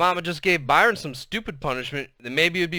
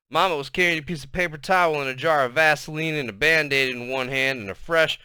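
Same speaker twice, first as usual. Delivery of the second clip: usual speaking voice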